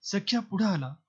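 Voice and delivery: usual speaking voice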